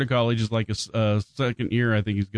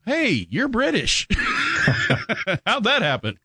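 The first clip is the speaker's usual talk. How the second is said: surprised; 8 semitones higher than usual. Retold or imitated. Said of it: "and I'm like".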